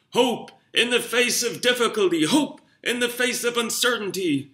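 orate it like a presidential hopeful; Obama speech impression. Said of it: "that's what I'm gonna feel like when I'm like".

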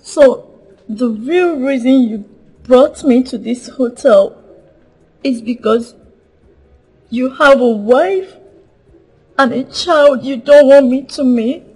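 confronting. Acted. Confronting lover about hidden wife.

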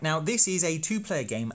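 normal talking voice

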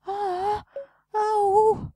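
high squeals of surprise; delighted surprise. Real, not acted. Shop owner thrilled by a customer's present, high squeal. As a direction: gasp with delight at the gift.

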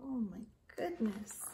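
hushed stage whisper. Acted. Reader whispering to young listeners in mock alarm. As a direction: share a secret with the children.

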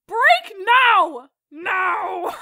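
loud sharp command; shouting. Real, not acted. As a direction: bark the order out. Yelling at the others.

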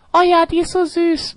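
hammed-up gushing fan. Mocks a fan's gushing.

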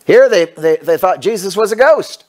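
preacher retelling the miracle, fired up; preaching at full force. Acted. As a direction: drive the story home to the congregation. Retells the passage preaching hard.

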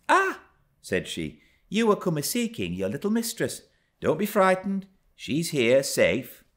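a kindly servant reassuring; servant woman voice. A narrator's reading.

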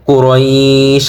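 "কুরাইশ" drawn out and stopped on sh; chanted "কুরাইশ" with no ending vowel; correct pausal pronunciation. Shown as a demonstration. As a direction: hold the glide in "কুরাইশ", then close on sh. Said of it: "তাহলে সঠিক উচ্চারণ হবে".

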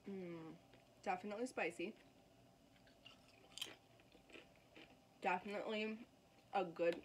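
talking between crunchy bites; chewing while talking. Real, not acted. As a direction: mouth full of chips. Seen 2: bites chip near 2 s, chews with closed mouth through 6.6.

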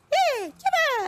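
put-on very high, squeaky kid voice; mock squeak of a young teen; squeaky fourteen-year-old. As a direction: chirp it like a boy before his voice breaks. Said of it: "when you're fourteen, you're like".

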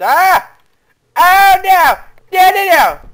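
high, very loud cries of dismay; panicked screaming. Real, not acted. Gamer sees the head get chopped, panicked screaming. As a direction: scream it out at full force. Screams as the game goes wrong.

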